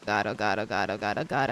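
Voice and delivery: low, hushed panicked muttering; terrified gibbering. Real, not acted. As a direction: babble it under your breath in fear. Panicked by fall holes in game.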